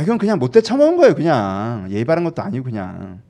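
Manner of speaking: ordinary delivery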